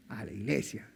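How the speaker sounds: a hint of a firm mother; stern mother. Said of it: "ella me decía". (madre firme, mucho más grave y seca)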